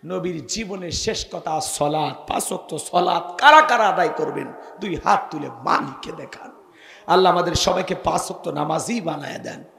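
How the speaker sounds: ordinary talk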